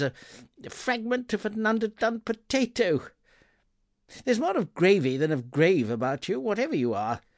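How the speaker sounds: put-on high, scoffing old miser; Scrooge. A narrator's reading. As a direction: brush off the ghost with scorn. Scrooge voiced in narration.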